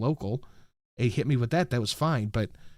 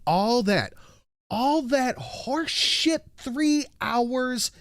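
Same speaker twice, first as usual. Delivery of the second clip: high, slow, incredulous complaint; worked-up disbelief; exasperated rant; 12 semitones higher, much slower than usual. Real, not acted. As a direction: stretch out each grievance, pitched way up. Ranting about hours for one stitch.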